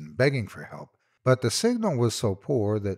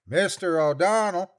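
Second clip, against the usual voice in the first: second searcher calling; 10 semitones higher, 4 dB louder than usual. A narrator's reading. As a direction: stretch the name out across the hills. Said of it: "a different voice than the first".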